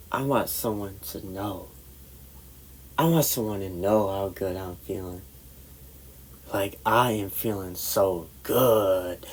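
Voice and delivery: soft, low musing to oneself; hushed, dreamy inner voice; retold private thought. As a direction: confide it quietly, lingering on the end. Retold tipsy thought before confiding.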